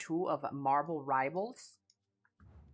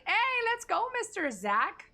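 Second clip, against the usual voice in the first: excited greeting; 13 semitones higher, 3 dB louder than usual. Real, not acted.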